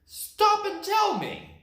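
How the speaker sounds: high, loud put-on bear voice; demanding bear. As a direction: call after the fleeing rabbits.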